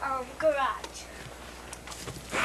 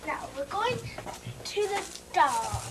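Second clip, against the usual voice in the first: ordinary talk, nothing marked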